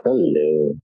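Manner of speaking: usual speaking voice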